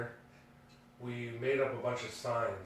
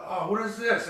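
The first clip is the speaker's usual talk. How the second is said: higher, louder mock disgust; 9 semitones higher, 5 dB louder than usual. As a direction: recoil at what's on your hands. Finding dog mess in leaves.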